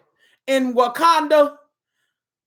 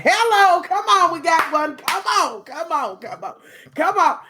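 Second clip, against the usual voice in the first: high, loud urging, repeated insistently; calling out; 6 semitones higher, 4 dB louder than usual. Acted. Coaxing a girl back into the game, calling out. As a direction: call them over, pushing each repeat.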